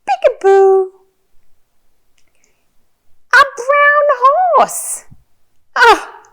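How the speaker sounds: put-on very high, loud, slow toddler-directed delight; excited reveal. Shown as a demonstration. Revealing the horse to a little one, excited. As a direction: squeal the surprise out, stretching the words.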